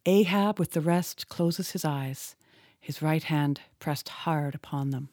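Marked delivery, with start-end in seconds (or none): none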